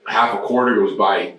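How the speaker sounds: ordinary delivery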